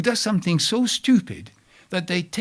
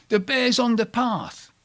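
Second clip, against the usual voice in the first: higher-pitched mild mock shout; retold shout; 8 semitones higher than usual. Someone warns the planters between the tents, a mild retold shout. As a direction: imitate someone calling out a warning, pitched up. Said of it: "someone shouts".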